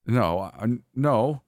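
halting, rising protest; stammered denial. A narrator's reading. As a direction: stumble over a flustered no. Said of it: "I stammered".

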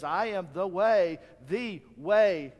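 "the way" with "the" hit loud and held, slowed down; stress on THE way. Shown as a demonstration. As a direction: preach it loud, landing hard on "the" in "the way". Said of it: "not a way".

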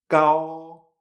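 kao held long on a level tone, no puff of air; first tone. Shown as a demonstration. Teacher modelling the example, kao in a sustained first tone. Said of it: "G A O en primer tono".

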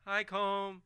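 high, sing-song friendly voice; goofy Bradley. Acted. Bradley cheerfully greeting Colm in a high goofy voice. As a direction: play him dopey and cheerful.

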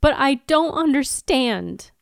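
drawn out in confusion; bewildered Anya. Acted. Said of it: "have you be Willow".

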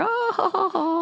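high, hooting oh-ho-ho; mock laughter. Imitating reaction to a bean eater joke, high mock laugh.

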